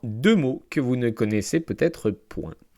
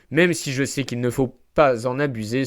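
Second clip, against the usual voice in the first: ordinary talk, nothing marked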